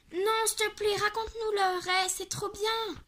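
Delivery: put-on high begging kid; pleading child voice. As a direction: beg like a small child. Child begging for more story.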